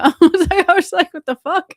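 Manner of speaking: high, loud laughing what-the-fuck; laughing in disbelief at being called the speaker. Retold or imitated. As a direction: squeal through a laugh at the absurdity. Security calls her the speaker.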